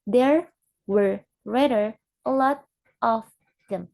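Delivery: word by word. Shown as a demonstration. Teacher showing unweakened phrase, There were rather a lot of them word by word. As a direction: give every word its full form, one by one. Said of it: "When we are shade this word one by one".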